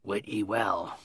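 low, soft mutter to himself. Acted. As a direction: mutter it under your breath, low. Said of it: "and muttered, apparently to himself".

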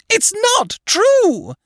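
very high, slow shriek of denial; screaming. A narrator's reading. Wife refuses to believe the loss, screaming. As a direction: shriek it in horrified disbelief. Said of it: "she screamed".

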